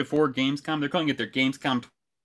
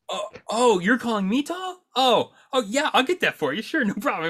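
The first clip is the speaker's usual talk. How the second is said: mock-sweet overeager helper; 8 semitones higher than usual. Mock-sweet reply to rude lady.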